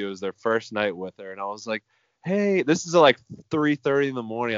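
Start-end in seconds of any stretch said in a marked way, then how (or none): 2.2-4.6 sheepish call. Retold or imitated. Awkward late night call.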